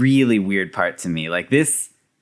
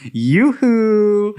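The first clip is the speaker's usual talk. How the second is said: higher sing-song call; teasing call for attention; sing-song yoo-hoo, pitched up; mock yoo-hoo; 9 semitones higher, 4 dB louder than usual. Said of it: "to get Francis's attention".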